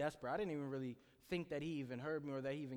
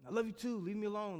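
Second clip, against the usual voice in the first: much higher, louder brush-off; irritated outburst; 8 semitones higher, 7 dB louder than usual. Retold or imitated. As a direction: snap it out, annoyed and sleepy. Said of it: "I'd be mad".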